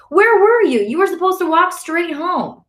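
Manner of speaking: high-pitched, loud scolding; angry brother shouting. A narrator's reading. Brother scolding Ali for not coming straight home, angry shout. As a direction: demand answers at a yell. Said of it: "voice carries down our street".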